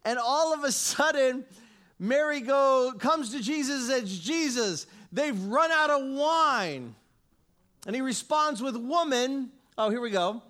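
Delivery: loud, fervent pulpit delivery; preaching at full force. Acted. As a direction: preach it out to the whole room, full voice. Preaches loudly to congregation.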